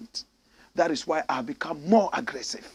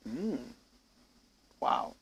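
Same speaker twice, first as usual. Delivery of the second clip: ordinary delivery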